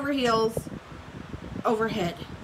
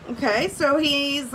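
ordinary delivery

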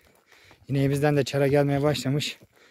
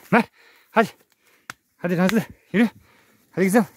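raised coaxing call; 7 semitones higher, 5 dB louder, faster than usual. Real, not acted. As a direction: coax the cow forward. Coaxing cow by name.

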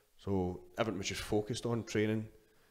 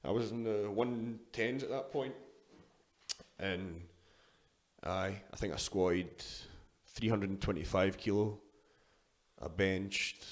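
usual speaking voice

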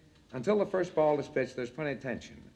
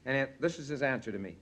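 normal talking voice

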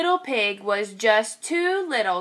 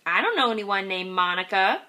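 lower, quick deadpan grandma; lightly voiced grandma deadpan; 3 semitones lower, faster than usual. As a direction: answer dryly like an old grandma. Grandma's joke reply.

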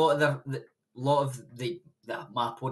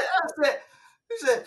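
normal talking voice

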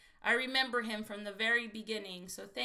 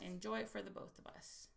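much softer, murmured second time; softer repeat; 4 semitones lower, 13 dB quieter than usual. Real, not acted. To a departing friend, softly repeated. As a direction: drop the repeat almost to nothing.

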